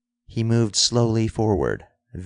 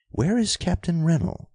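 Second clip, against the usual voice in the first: higher-pitched demanding question; raised-pitch, insistent retort; sharp retort; 9 semitones higher than usual. A narrator's reading. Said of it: "the girl retorted".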